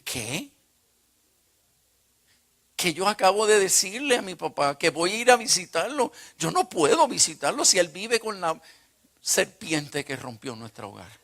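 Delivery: loud, high, incredulous outburst; outraged disbelief. Retold or imitated. Son aghast at promising to visit his father, outraged. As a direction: berate yourself in loud disbelief.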